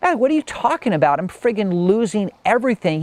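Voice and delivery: high-pitched, indignant outburst at no extra volume; retold anger. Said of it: "like, angry and like".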